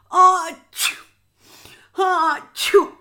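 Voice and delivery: two big high sneezes; loud sneezes. Acted. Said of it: "He sneezed loudly again and again".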